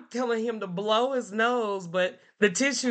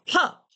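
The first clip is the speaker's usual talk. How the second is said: "cup" popped high and loud as one beat; one clapped beat; 9 semitones higher, 4 dB louder than usual. Shown as a demonstration. Teacher demonstrating syllables to the class. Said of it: "Or let's say".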